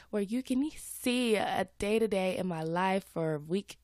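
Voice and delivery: usual speaking voice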